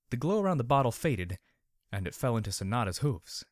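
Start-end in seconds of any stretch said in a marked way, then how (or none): none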